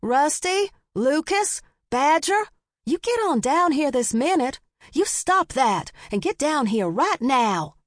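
high-pitched scolding yell; exasperated, high summons to children; calling up to rooftops. A narrator's reading. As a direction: order naughty boys down at once. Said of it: "before he could really start yelling".

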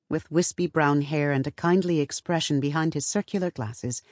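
ordinary delivery

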